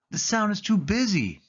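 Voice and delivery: high, bright-eyed exclamation as Sally; Sally exclaiming that the town is too busy. A narrator's reading. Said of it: "Sally exclaimed".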